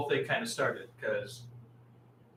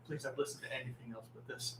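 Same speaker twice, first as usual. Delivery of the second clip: ordinary talk, nothing marked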